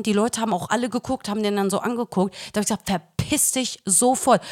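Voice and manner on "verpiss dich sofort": ordinary talk, nothing marked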